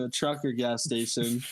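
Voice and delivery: ordinary talk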